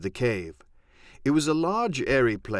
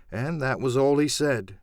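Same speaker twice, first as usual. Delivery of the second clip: ordinary delivery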